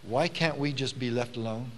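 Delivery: soft, low, understated timid plea; mild timidity. Retold or imitated. Rosa Parks appealing to the sheriff, mildly timid. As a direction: quietly voice a timid woman, lightly. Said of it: "looks up kind of timidly and says".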